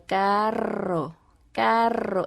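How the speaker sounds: "carro" slowly with a long trilled R; rolled R. Shown as a demonstration. Mother teaching daughter, "carro" with a long rolled R. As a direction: draw out the vibrating rr in "carro". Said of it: "cuando pronuncio la R".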